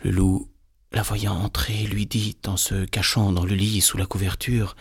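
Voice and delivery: usual speaking voice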